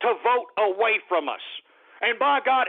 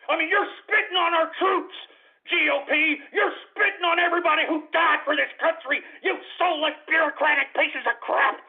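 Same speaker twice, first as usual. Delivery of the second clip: raised-pitch, heated tirade; angry berating; 5 semitones higher than usual. Real, not acted. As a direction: lay into them with outrage. Berating the party in rage.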